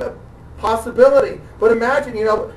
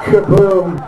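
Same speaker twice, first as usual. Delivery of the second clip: punchy mock explosion, louder; kaboom sound effect; 3 semitones lower, 6 dB louder than usual. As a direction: blurt it like a cartoon blast. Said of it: "I just do the kaboom".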